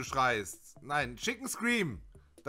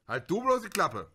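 ordinary talk, nothing marked

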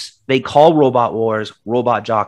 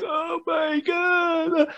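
much higher, slow, drawn-out mock lament; mocking Achilles moaning in a slow, much higher wail; mock despair; 8 dB quieter, much slower than usual. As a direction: stretch it out like an overdone tragic hero. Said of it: "five minutes of him like".

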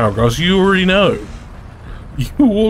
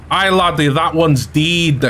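put-on Scottish accent; 2 semitones higher than usual. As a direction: play a Scot addressing a laddie. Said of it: "I'm trying to do Scottish".

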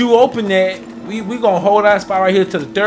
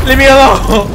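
loud plea; 4 semitones higher, 8 dB louder than usual. Real, not acted. Fleeing zombies.

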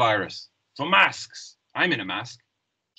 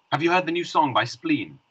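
ordinary talk

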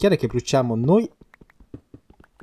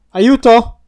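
high, loud panicked yell in play; mock cry for help; 10 semitones higher, 8 dB louder than usual. Trapped player yells to partner for help, mock panic. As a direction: shout for rescue, hamming it up.

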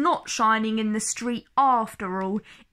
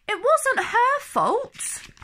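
put-on high, slow, pleading child's voice; little girl voice; 12 semitones higher, slower than usual. Debbie defending Mog to her mother, high and slow like a child. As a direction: plead gently for the cat like a small girl.

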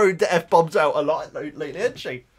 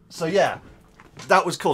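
ordinary delivery

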